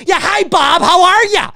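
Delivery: a rude patron answering a call in the theater, mocked; mock phone caller. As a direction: ham up someone taking a call mid-movie. Mocking loud phone caller.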